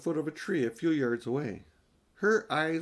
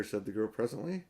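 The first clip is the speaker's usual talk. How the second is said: ordinary delivery, nothing marked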